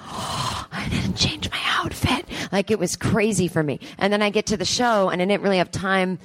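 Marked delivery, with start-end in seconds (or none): 0.1-2.3 gasped realization. Retold or imitated.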